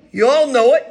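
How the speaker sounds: ordinary delivery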